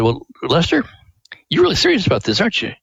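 lightly imitating a moved shop owner; touched amazement. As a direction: marvel at his earnest plea. Print shop owner voiced.

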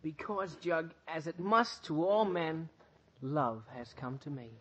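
slow, low, grand pronouncement; solemn declaration. Acted. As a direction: announce love with lofty gravity. Archie solemnly declares his love.

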